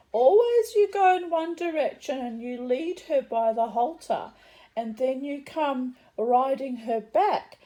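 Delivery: high, loud, baffled Polish accent imitation. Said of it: "in a strong Polish accent".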